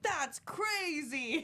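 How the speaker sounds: high, long-drawn exclamation. Retold or imitated. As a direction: stretch it out high, quoting a funny line. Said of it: "He's like".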